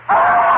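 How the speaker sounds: piercing high shriek; terrified scream. Acted. Narrator seeing the dream woman in his room, screaming. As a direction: shriek in sheer terror.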